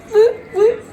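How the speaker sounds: high, gleeful whoop; cheering herself on; excited cheer. Real, not acted. Celebrating her own cooking.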